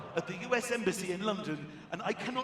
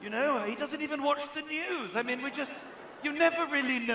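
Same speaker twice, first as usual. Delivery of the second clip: bewildered wife; 4 semitones higher, 6 dB louder than usual. Retold or imitated. Wife on news, continued.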